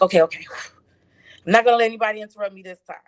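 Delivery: loud, quick retelling of being fed up; retold firm resolve. Woman telling herself nobody will interrupt her praying, retold firm resolve. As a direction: huff it out loudly, then rush on.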